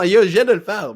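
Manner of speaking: loud, high opening of a phrase; starting loud. Shown as a demonstration. Coach shows how a descending phrase begins, loud. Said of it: "commence ta phrase avec un volume plus haut".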